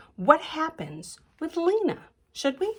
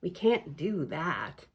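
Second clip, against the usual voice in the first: frowning; 8 semitones lower, 6 dB quieter, slower than usual. Acted. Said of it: "Lena frowns".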